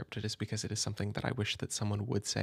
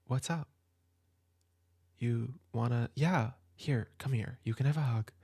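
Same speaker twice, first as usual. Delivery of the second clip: put-on a gentle older sibling comforting a soaked kid at midnight; an older sibling at midnight, welcoming and comforting the upset kid in from the rain; caring older sibling. Plays a caring sibling at night.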